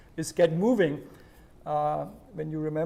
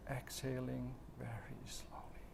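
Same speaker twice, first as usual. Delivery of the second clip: whispered guidance; 4 semitones lower, 17 dB quieter than usual. Shown as a demonstration. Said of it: "whispered guidance".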